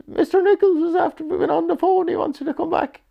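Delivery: very high, loud West Country voice, bursting with news; squeaky, excited West Country accent. Retold or imitated. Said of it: "as Brody says in the West Country accent".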